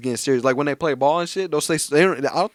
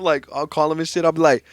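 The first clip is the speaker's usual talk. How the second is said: ordinary talk, nothing marked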